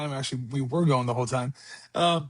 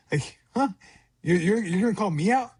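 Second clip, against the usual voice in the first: higher, incredulous huh; mock stunned; 5 semitones higher than usual. Father retells being called a hypocrite, mock stunned disbelief. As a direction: play being caught off guard by a kid.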